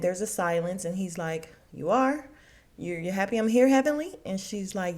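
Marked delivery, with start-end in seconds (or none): 1.7-4.1 higher, louder, rising doubtful question; incredulous questioning. Retold or imitated. Scott questioning his hostess after awkward silence, incredulous. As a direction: question it as if unconvinced.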